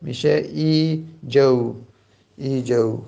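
ای جو said slowly with a clear i; slow repeat of ای جو; corrected form. Shown as a demonstration. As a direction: give ای جو as the final answer, slowly.

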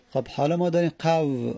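ordinary talk, nothing marked

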